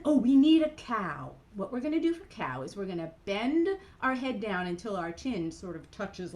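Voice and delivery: ordinary talk, nothing marked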